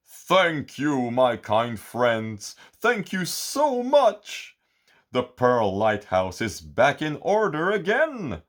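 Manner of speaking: high, slow heartfelt thanks; grateful sea giant. A narrator's reading. Sea giant thanking Goody and Gooda for the pearl, heartfelt.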